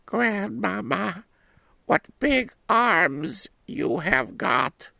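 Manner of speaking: put-on very high, slow wondering girl; little girl voice. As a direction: ask like an astonished small girl. Girl questions grandmother.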